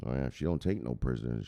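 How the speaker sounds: ordinary talk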